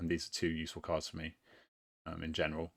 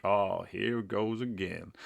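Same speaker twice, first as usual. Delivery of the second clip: slow, lightly imitated game meme line; meme quote; slower than usual. Player quotes a game meme as play repeats, lightly imitated. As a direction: draw it out as a weary meme quote. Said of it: "G T A meme, like".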